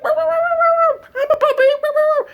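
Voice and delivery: very high squealed puppy yapping; little puppy. Acted. Reborn man finding he's a puppy, excited puppy yapping. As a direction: yap it out in a tiny, squeaky puppy voice.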